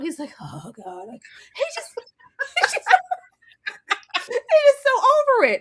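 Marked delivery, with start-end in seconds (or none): 0.4-1.1 very soft, low exasperated groan; mock weary huff under the breath; imitated huff and puff. Imitating him loading her suitcases, huffing wearily. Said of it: "the huff and puffs". 1.1-5.6 high-pitched, laughing through the words; real amusement. Real, not acted. Friend recapping how fed up he is, laughing. Cracking up at his exasperation.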